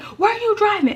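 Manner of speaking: high-pitched mock shriek; imitated screaming. Mom questioning her child after the accident, imitated screaming. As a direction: shriek the question like an alarmed mother. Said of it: "now she's screaming".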